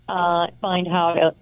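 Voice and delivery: ordinary talk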